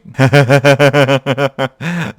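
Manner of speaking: long burst of delighted laughter; sustained, gleeful giggling; tickled laugh. Real, not acted. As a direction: crack up at an adorable sight. Dog wears welding goggles.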